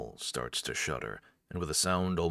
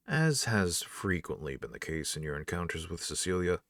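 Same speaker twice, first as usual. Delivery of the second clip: usual speaking voice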